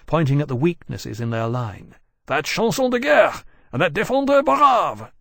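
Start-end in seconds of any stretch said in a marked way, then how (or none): 2.3-5.1 calling out. Acted. Lawrence pointing out targets in battle.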